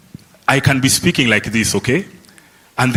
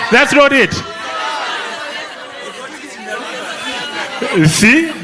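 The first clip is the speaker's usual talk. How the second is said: words jump much higher, a put-on vocal slip; deliberate slip; 13 semitones higher than usual. Shown as a demonstration. Teacher faking a slip for his students, much higher. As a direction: crack your voice up high on purpose. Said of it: "I did that intentionally".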